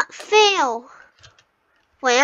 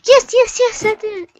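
high, loud yes's of victory; triumphant cheer; 7 semitones higher, 5 dB louder, faster than usual. Real, not acted. Player finally landing the hard jump, cheering. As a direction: burst out in relief and joy.

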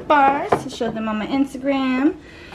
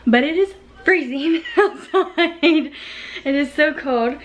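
laughing; 3 semitones higher than usual. Real, not acted. Laughs about the cold.

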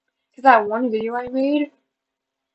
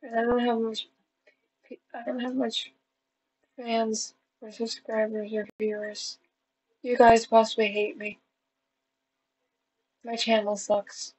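dejected; 8 dB quieter than usual. Real, not acted. Vlogger telling viewers the channel sucks, softly dejected. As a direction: softly admit defeat, slowing down.

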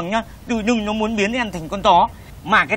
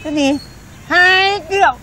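shocked disbelief; 7 semitones higher than usual. Acted. Customer echoes the seller's huge price, shocked.

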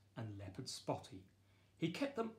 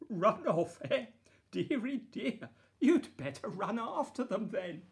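giggly maid; 8 semitones higher, 7 dB louder than usual. Acted. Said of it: "The maid gave a giggle".

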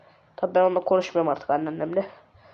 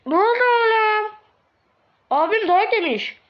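high-pitched, drawn-out gossip voice; high put-on voices; 10 semitones higher, slower than usual. Others gossip about brother's beating, high drawn-out put-on voice. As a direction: squeak it out slowly. Neighbours or siblings hear the gossip.

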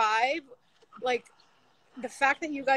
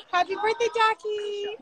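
higher-pitched, gushing birthday greeting; birthday cheer; 8 semitones higher, 4 dB louder, slower than usual. Real, not acted. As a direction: sing out the wish with delight. Birthday wish to the son.

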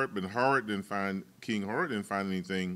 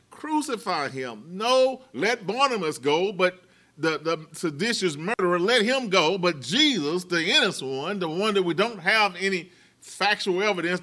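impassioned crowd voice, pitched higher; preacher voicing the mob demanding crucifixion, heated; mock crowd outcry; 6 semitones higher, 5 dB louder than usual. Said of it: "So they cried out again".

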